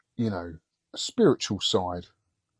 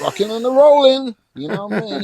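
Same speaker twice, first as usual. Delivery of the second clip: rock and roll hype; 13 semitones higher, 10 dB louder than usual. Acted. Hyped rock and roll greeting.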